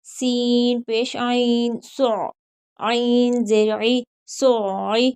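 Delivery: slow, drawn-out letter-by-letter recitation; slow tajweed drill. Shown as a demonstration. Teacher drilling students letter by letter, slow recitation. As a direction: spell and join each syllable like a Quran teacher.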